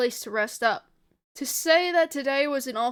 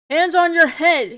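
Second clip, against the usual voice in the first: loud, raised-pitch soldier's command; shouted order; 6 semitones higher, 12 dB louder than usual. A narrator's reading. Soldier to detainees who must obey, shouted order. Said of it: "he shouts as we do so".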